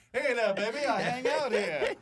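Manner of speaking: high, laughing mock chat-up; sleazy street lad. Joining the loiterer bit.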